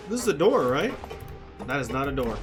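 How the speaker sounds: normal talking voice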